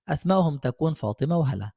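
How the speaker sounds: ordinary talk, nothing marked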